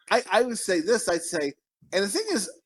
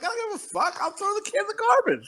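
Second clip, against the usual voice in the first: much higher voice, tossed off; carefree mock self; 3 dB quieter than usual. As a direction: play her past self not caring a bit. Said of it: "but I'm like".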